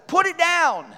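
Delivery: high-pitched acted order; retold yell. Older thief ordering the younger one, mock yelling. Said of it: "they start yelling".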